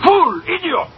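sharp high furious insults; angry shouting. Acted. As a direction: yell each insult in rage. Boss yelling insults at cashier.

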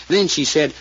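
ordinary talk

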